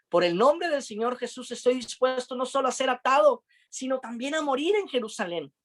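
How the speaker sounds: high, impassioned declaration; fervent vow. Retold or imitated. Pablo vowing to his friends he will die, high and fervent. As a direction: proclaim it with burning resolve.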